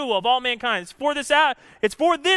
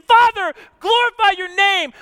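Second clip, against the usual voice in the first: loud cry; 9 semitones higher, 7 dB louder than usual. Acted. Same prayer cried out.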